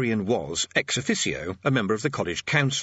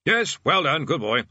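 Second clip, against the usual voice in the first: higher, brisk dismissive praise; breezy; 5 semitones higher, 4 dB louder than usual. Acted. Bursar brushes off the orangutan's suggestion, breezily. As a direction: brush it aside brightly. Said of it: "said the bursar breezily".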